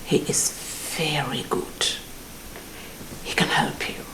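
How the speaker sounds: hushed conspiratorial whisper; unvoiced secretive whisper. Secret suggestion of juju man.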